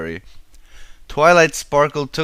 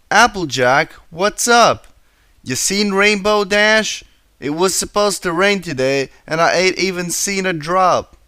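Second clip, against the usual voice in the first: Applejack voiced; 7 semitones higher, 4 dB louder than usual. A narrator's reading. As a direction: ask Twilight about the rain, higher. Applejack voiced talking to Twilight.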